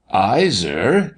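usual speaking voice

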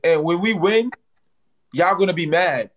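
ordinary delivery

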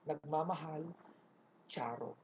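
low, soft sign-off; sign-off as Ma'am Charo. Retold or imitated. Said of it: "Si Ma'am Charo". (mahinang paalam ng boss)

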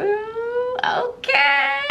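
very high, tearful squeal; squeal, nearly crying at the gift; moved squeal. Real, not acted. Seeing her child's picture on a gift, moved squeal.

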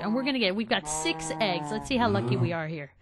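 normal talking voice

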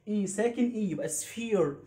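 usual speaking voice

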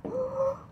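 sharp, high theatrical gasp; mock gasp. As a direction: gasp high, hamming up the shock. Mock shock at recurring light pole.

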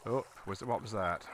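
ordinary talk

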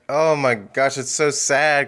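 ordinary talk

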